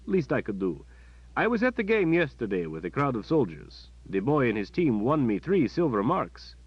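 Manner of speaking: the kindly palace captain; captain's voice. Acted. Captain telling Kalen about the waiting boy, friendly.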